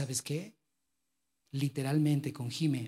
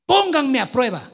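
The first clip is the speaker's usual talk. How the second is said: bold challenge; 7 semitones higher, 11 dB louder than usual. Retold or imitated. God's challenge quoted.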